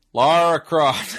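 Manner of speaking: badly faked American drawl; hammed-up American accent. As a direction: belt it out in an overdone American accent. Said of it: "he drops his American accent".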